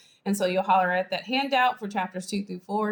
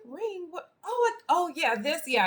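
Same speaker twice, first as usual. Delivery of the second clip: feigned surprise; 8 semitones higher than usual. Acted. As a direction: play it down as if you hadn't noticed. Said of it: "creating a false impression".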